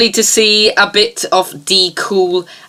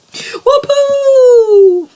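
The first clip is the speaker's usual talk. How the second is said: high loud whoop of triumph; whooping cry; 5 dB louder than usual. Acted. Host celebrating the end of the sketch, whooping. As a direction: let out a gleeful whoop.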